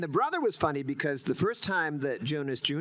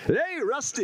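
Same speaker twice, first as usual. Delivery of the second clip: higher, louder, beaming hero voice; Happy Heston; 13 semitones higher, 4 dB louder than usual. Shown as a demonstration. Actor demos the brother's cheery Heston voice, bright and loud. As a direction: greet Rusty like a cheerful Heston. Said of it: "Happy Heston".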